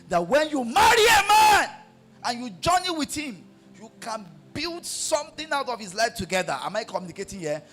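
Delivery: high, loud, shouted sermon; fiery preaching. Acted. Pastor exhorting wives to support husbands, shouted and high.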